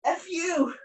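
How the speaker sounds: abs talking back. Pretend. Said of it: "every single one of my abs be like".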